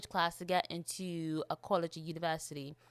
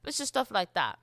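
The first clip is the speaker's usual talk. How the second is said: ordinary talk, nothing marked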